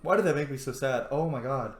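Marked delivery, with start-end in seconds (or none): none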